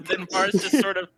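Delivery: usual speaking voice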